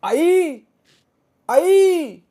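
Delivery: very high, long calls; loud desperate holler. A narrator's reading. Helpless Rama calls out for his mother, loud high holler. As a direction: holler for mother across the field. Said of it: "त्याने जोरात हाळी मारली".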